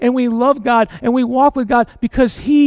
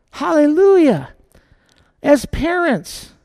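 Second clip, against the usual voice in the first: ordinary talk, nothing marked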